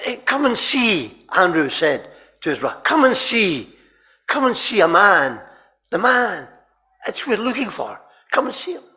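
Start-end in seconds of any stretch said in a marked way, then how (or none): 0.1-1.2 loud, higher summons; calling invitation. Acted. Disciple calls his brother to come and see, calling out. Quoting Andrew's urgent invitation. 2.8-8.8 raised, higher-pitched summons; calling invitation. Acted. Disciple urges his brother to meet the man, calling out. Quoting Andrew's urgent invitation.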